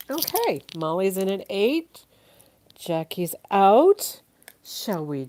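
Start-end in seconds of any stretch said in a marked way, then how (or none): 0.1-2.1 excited. Real, not acted. Auction bid announced. 2.8-4.2 loud, slow auctioneer call. Real, not acted. Auction bid called out.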